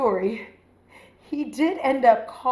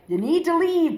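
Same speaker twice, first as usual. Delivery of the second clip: raised, higher-pitched mock demand; exasperated order; 9 semitones higher, 4 dB louder than usual. Retelling how she pushed an unwanted date out, exasperated. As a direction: re-enact an exasperated eviction order.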